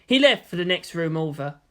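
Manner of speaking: ordinary delivery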